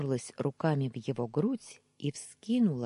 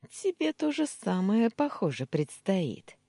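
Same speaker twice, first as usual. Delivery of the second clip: usual speaking voice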